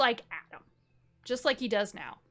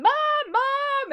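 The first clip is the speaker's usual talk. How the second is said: put-on very high, loud child calling mom; a scared kid yelling for her mother; panicked calling; 10 dB louder than usual. Girl runs calling for mother.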